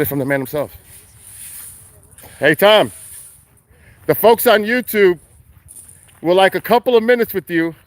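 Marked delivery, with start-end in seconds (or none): 2.4-7.7 calling out. Real, not acted. Calling out to Tom.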